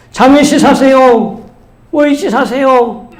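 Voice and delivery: high, drawn-out seed seller's call; imitated street vendor hawking; hawker's cry. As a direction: call out your wares slowly to the whole street. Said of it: "소리를 지릅니다".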